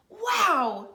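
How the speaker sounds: wow, like seeing something amazing; amazed wow. Acted. Norman admires the snails.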